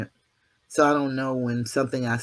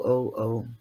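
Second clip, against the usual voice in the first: normal talking voice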